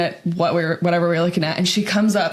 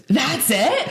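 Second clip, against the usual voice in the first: incredulous; 7 semitones higher than usual. Retold or imitated. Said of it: "And she goes".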